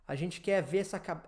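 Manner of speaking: ordinary talk, nothing marked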